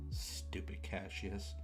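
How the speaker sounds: ordinary delivery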